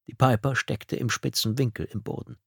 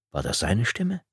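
plain reading voice

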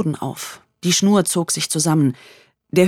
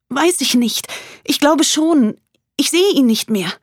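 frightened; 10 semitones higher, 4 dB louder than usual. Acted. Frightened woman on the phone.

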